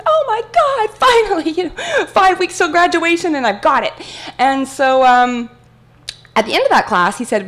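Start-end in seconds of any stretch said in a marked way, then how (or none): 0.0-3.9 high, gushing rush of relief; thrilled gush. Retold or imitated. Student thrilled at her professor's praise, high excited gush. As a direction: squeal it like the dream just came true.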